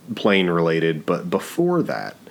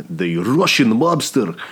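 bad Yuri accent; 3 semitones higher than usual. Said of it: "I can't do a good Yuri".

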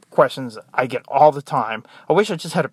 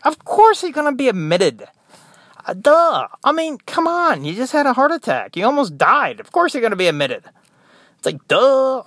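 high, emphatic mock disbelief; exasperated retort; 8 semitones higher, 4 dB louder than usual. Nurse to heart attack patient asking about admission, exasperated. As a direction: hammer home the obvious, pitched up.

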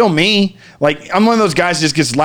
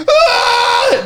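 much higher yelp of shock; high startled scream. Retold or imitated. Yanked into the sky, screaming. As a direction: shriek as if yanked upward.

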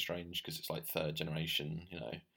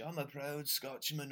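mock proud Scot; 7 semitones higher, 5 dB quieter, slower than usual.

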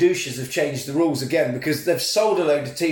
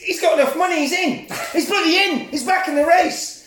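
higher, building to a shout of disbelief; announcer stunned by the news, mock excitement; excited announcer; 8 semitones higher than usual. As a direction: rise into astonished race commentary. Announcer reading the note.

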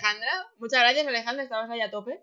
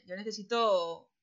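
normal talking voice

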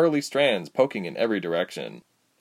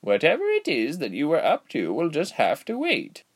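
cheery aged healer, pitched up high; cheerful old woman; 6 semitones higher than usual. A narrator's reading. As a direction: draw it out sweetly like a cheery granny. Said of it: "she said cheerfully".

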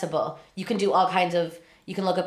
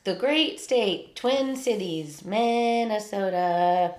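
slow, drawn-out announcer read; grand announcement; much slower than usual. Pretend. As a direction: stretch each name out like a proud host. Announcing state slowly.

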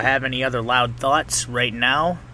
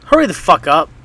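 mock yell; 6 semitones higher, 7 dB louder than usual. Telling off a slow walker.

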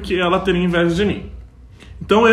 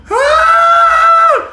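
long, very high, loud scream; mock scream; 8 dB louder than usual. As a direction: scream like a panicked movie victim. (grito agudo e escandaloso de filme de terror)

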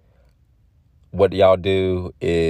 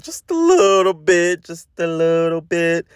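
playful high voice; 10 semitones higher than usual. Acted. Playful repetition while breaking it down.

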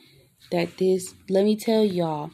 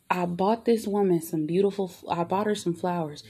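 ordinary delivery

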